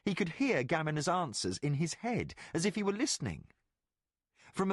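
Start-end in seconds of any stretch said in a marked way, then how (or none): none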